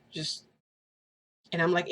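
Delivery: normal talking voice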